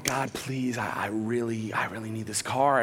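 soft, low, halting plea; pleading prayer. Retold or imitated. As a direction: pray it quietly, almost to yourself. Retold private prayer at home.

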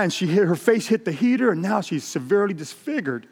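acted high-pitched, urgent confession; desperate man confessing his worst; anguished confession.

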